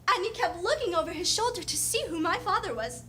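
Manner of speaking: normal talking voice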